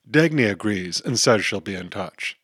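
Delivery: ordinary delivery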